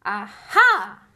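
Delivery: very loud gotcha; Harry catching Hagrid out; triumphant aha. Acted. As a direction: pounce on the slip.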